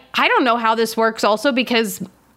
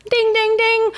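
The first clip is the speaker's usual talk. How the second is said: ding ding chime; 12 semitones higher than usual. Acted. Announcing news of a gig.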